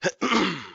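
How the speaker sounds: a rough, real clearing of the throat. Real, not acted. Webinar host losing his voice clears throat. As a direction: clear a tired, failing voice. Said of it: "Excuse me, losing my voice here".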